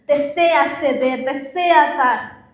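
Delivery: muy lenta, arrastrando cada palabra; slow emphatic. Real, not acted. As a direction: deja caer cada palabra despacio. Predicadora subraya el deseo del corazón. (lento y enfático)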